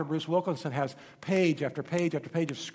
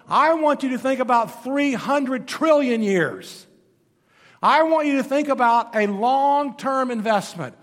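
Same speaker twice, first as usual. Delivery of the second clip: louder, slow emphatic urging; Jesus urging; 5 semitones higher, 6 dB louder than usual. Retold or imitated. Jesus urging listeners to think eternally, loud and slow. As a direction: press the point home with Jesus' voice. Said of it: "Jesus is going".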